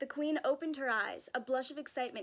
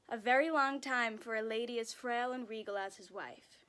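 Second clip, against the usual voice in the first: plain reading voice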